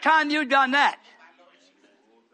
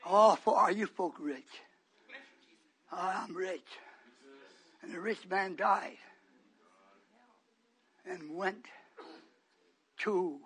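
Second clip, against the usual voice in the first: much lower, soft, spaced-out preaching; hushed preaching; 6 semitones lower, 10 dB quieter than usual. Real, not acted. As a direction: draw them in with a hush.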